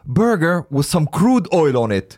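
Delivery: high-pitched, eager imitation of a friend; super excited. Imitating a friend selling his burger idea, super excited. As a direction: pitch the silly idea with mock enthusiasm. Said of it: "He's, like, super excited about it".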